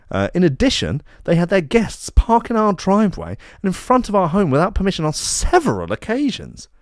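aggrieved complainant. Acted. Reads out neighbour's complaint post.

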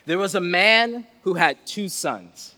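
ordinary delivery

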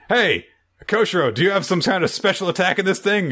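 high, fast excited shout; excited call. Retold or imitated. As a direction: call out eagerly mid-fight. Hero calls out mid-fight.

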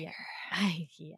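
whispered, like a shared secret; gushing whisper. Real, not acted. Fan whispering her crush on Ewan McGregor, gushing. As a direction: breathe it out as a fond confession. Agreeing in a hush.

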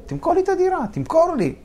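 retold yell, pitched well up; shouting back. Negotiator shouting back at the screaming owner, insistent high yell. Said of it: "ככה, צעקתי עליו חזרה".

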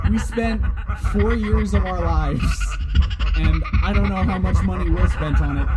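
talking over canned laughter; loud over the laugh track. Pretend. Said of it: "that's our live studio audience".

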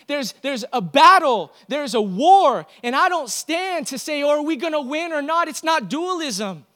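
loud, high-pitched preaching to the church; rally the congregation with fire; full-force preaching. Acted.

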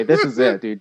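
ordinary delivery

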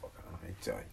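normal talking voice

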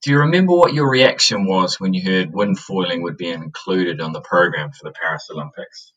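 echoey, hollow room sound; cave echo. Real, not acted. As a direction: voice bouncing around a cave. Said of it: "I sound like I'm in a cave".

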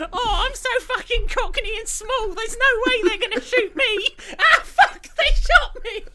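very high, squeaky mock-cockney child voice; Gavroche. Cocky cockney urchin getting shot, high comic impression. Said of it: "here's my impression of Gavroche".

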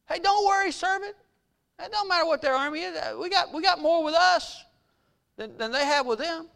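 high, light voice for the prophet; high-pitched, offhand imitation of a prophet; casual reassurance. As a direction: breezily wave the worry away in a high voice.

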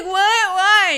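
playing herself stunned by therapist's warning; mock shock. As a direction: squawk in disbelief.

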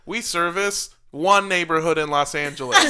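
loud, cheesy realtor commercial; TV ad pitchman. Pretend. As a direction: sell it like a local TV ad. Mock realtor advert, loud.

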